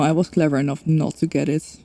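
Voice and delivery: usual speaking voice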